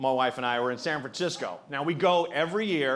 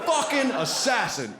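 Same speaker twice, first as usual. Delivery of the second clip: laughing shout; 7 semitones higher than usual. Retold or imitated. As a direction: shout it, cracking up. Repeated louder with laugh.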